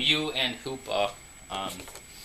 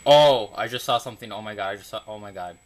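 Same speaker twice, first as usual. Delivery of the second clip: excited gasp; 2 semitones lower, 10 dB louder, faster than usual. Real, not acted. Spots a rare card while unboxing.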